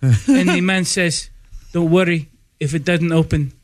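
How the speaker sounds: put-on halting joke in a foreign accent; deadpan accented broken English. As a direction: tell it in short phrases with an imitated accent. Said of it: "in broken English".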